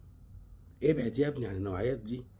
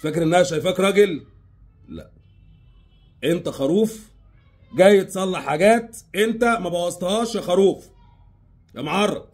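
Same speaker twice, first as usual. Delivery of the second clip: louder, higher scolding shout of insults; angry berating; 7 semitones higher, 9 dB louder than usual. Acted. Scolding the man who fixes her mess, loud and angry. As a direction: tear into him, calling him a sheep.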